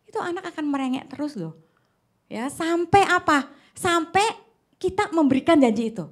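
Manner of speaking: ordinary talk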